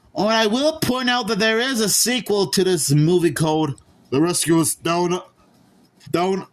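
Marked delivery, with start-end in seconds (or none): 4.1-6.5 put-on lightly done Aussie accent, halting; a try at Australian; hint of an Aussie twang. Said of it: "Sorry for my terrible Australian accent".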